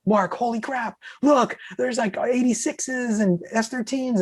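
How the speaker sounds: high, thin voice of amazement; awestruck excitement. Retold or imitated. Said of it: "I just couldn't believe it".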